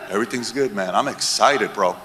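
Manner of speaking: low, soft, unbothered reply; lightly voiced laid-back guy who isn't worried; calm long-term thinker. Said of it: "you'll walk around the room like this".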